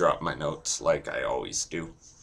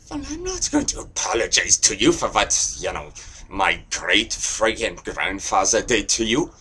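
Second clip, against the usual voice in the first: put-on German accent; 3 semitones higher, 4 dB louder than usual. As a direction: refuse hotly in a German accent. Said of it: "is that a German over there?".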